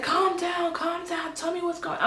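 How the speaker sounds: acting out a husband trying to calm her; imitated urgent calming. Husband soothing her panic, retold.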